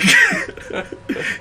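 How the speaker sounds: shrieking cackle. Real, not acted. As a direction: howl with laughter. Prank payoff.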